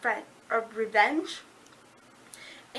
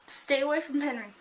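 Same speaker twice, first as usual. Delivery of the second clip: normal talking voice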